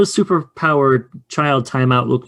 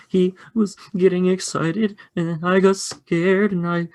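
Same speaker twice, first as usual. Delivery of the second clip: put-on high, slow little-kid voice; timid child drawing out the account; scared Kenny; 7 semitones higher, slower than usual. Preschooler telling teacher what happened, high slow timid voice. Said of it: "And Kenny's like".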